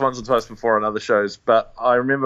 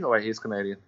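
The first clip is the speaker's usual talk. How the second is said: ordinary delivery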